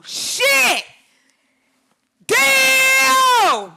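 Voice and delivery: overjoyed screams at her own photo; real screaming. Real, not acted. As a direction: scream with pure delight. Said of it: "I'm yelling and screaming".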